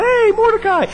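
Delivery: very high sing-song mock greeting; gleeful hailing. King who holds the knife greets Mordecai, mock gleeful.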